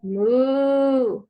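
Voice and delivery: put-on a sing-song "moo" for a toddler; playful "moo" to teach a child; cow sound. Shown as a demonstration. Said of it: "you might make the sound first".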